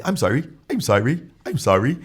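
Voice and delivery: light British accent impression; imitated British accent. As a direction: parrot a British apology, each one lifting at the end. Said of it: "You heard the accent".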